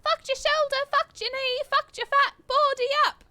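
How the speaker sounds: high, loud bratty taunts; bratty kid gloating. Acted. Kuno taunting the fallen detective, high gloating. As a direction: gloat like an unstoppable brat.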